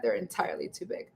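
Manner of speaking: soft, fast whisper. Acted. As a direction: whisper it under your breath. Whispered reread.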